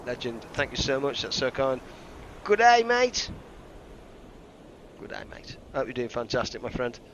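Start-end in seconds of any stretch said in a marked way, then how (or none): 2.4-3.3 loud, high Australian greeting; mock Australian. Greeting donor in Aussie style. 5.0-5.5 whispered Australian greeting; quiet Australian whisper. Pretend. Repeats greeting.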